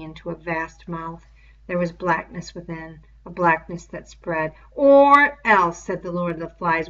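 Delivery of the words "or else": much louder, higher warning; menacing threat. Acted. The beast threatening Simon, loud and high. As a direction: bark the threat at him.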